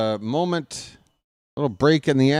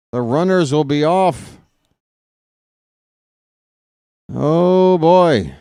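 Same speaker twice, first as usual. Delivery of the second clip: baseball announcer building tension; play-by-play call; 2 semitones higher than usual. Acted. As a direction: call each pitch like a radio broadcaster, growing keyed up. Announcer calling a ball game.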